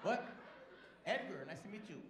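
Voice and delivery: normal talking voice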